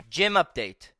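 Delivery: ordinary talk, nothing marked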